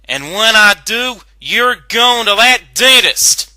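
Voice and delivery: loud, high shout into an empty store; high, loud frustrated shouting voice. Acted. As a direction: call out angrily after the runaway. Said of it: "Applejack shouted into the store".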